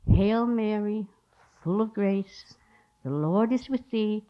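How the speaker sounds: low, measured praying, each phrase set apart; mimicked unhurried rosary recitation; slow, nice prayer. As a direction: imitate a slow, drawn-out Hail Mary. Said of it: "she prayed slowly and nice".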